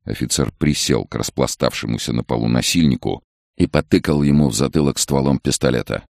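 usual speaking voice

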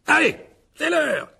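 high, slow drawn-out call. Acted. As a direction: call the pupils in, stretching the words. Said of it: "cria Lockhart à l'adresse des élèves". (appel chantant et aigu aux élèves)

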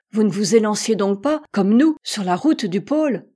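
ordinary delivery

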